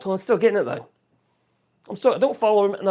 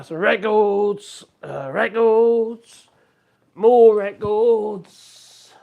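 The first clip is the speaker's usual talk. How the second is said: slow, louder, savouring each word; drawn-out showing off; 6 dB louder, much slower than usual. Real, not acted. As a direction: drag it out proudly. Showing record collection on tour.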